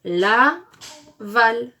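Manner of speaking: "la" and "val" as two loud, separate beats; syllable by syllable. Shown as a demonstration. Teacher assembles syllables for pupils, "la" and "val" loudly split. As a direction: break between "la" and "val". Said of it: "Donc nous avons".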